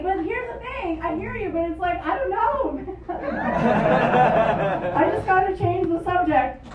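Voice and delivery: ordinary delivery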